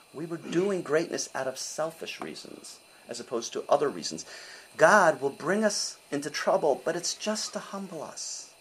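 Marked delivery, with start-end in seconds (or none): none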